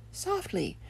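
high, hushed little branch voice; soft branch. Retold or imitated. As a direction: breathe it small and high. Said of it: "And the branch said".

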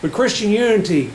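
fervent preaching. Acted. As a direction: preach it to the congregation. Preaching to congregation.

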